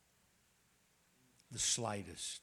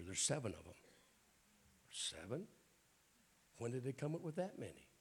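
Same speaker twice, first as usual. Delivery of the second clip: normal talking voice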